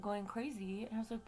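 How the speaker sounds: normal talking voice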